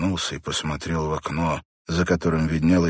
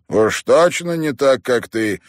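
higher, louder, drawn-out grumpy retort; displeased grumble; 7 semitones higher, 6 dB louder than usual. A narrator's reading. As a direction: grumble back at the doctor, stretching the words. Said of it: "недовольно проворчал Мэтт". (недовольное ворчание)

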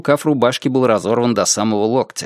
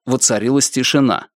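plain reading voice

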